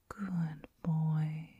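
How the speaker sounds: ordinary delivery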